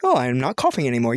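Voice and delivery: ordinary talk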